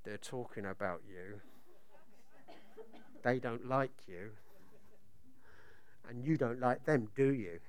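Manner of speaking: a low, hushed devil's taunt; devil's whisper. Acted. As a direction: lean in and hiss the accusations softly. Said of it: "he would say this to you".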